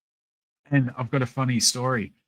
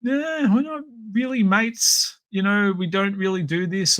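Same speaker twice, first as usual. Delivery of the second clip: mocking other collectors; 8 semitones higher than usual. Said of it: "what I hear from other collectors, it's".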